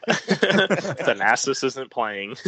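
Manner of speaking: laughing through the words; slow, amused mock report. Mocking dramatic news.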